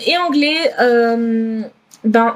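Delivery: ordinary talk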